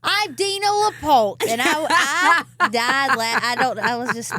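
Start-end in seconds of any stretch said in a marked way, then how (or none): 0.0-1.5 loud, high, drawn-out impression; Dina. Singer imitating her lawyer's video introduction, big impression. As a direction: announce yourself slowly and loudly, mimicking her. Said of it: "and you're like".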